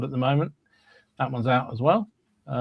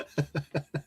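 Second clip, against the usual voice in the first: short awkward chuckle; a quick uneasy laugh; nervous laugh; 2 semitones higher, 9 dB quieter than usual. Real, not acted. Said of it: "That's such a nervous laugh".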